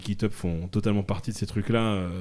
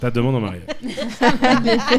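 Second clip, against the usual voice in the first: laughing; 9 semitones higher, 4 dB louder, much slower than usual. Real, not acted. Teasing about marriage proposal.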